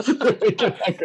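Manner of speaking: laughing the words out, high and loud; giggling through the line. Real, not acted. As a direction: crack up mid-sentence. Laughing at the coffee joke.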